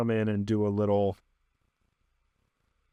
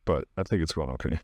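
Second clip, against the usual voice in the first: tense, breath held, low; holding breath; 6 semitones lower than usual. Real, not acted. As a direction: speak while holding breath. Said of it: "I'm holding my breath a little bit".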